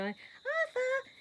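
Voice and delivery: high ringing call of Arthur; calling the horse. Shown as a demonstration. Demonstrating how she will call her horse, high ringing call. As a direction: call him across the field. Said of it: "have him coming to".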